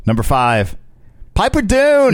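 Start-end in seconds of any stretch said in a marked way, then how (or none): 1.3-2.1 excited. Pretend. Excited announcement.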